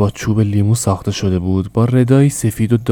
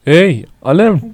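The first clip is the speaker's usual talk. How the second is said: calling out; 8 semitones higher, 5 dB louder, slower than usual. Acted. Mother calls out to son.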